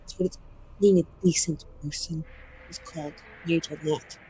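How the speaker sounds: soft, measured mage speech; restrained defiance. Acted. Mage telling off someone over who decides their fate, quietly defiant. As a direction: keep the defiance low and contained. Player repeats mage's bitter speech.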